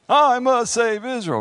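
high, loud mock-heroic vow; singsong heroics; cartoon hero. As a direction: declare it like a little cartoon champion. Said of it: "Now, VeggieTale says".

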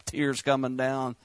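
normal talking voice

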